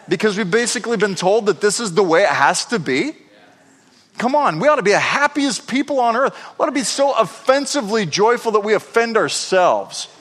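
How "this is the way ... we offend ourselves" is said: higher, fervent exhortation; rally the congregation; preaching. Acted. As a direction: passionate sermon push. Preaching at full force.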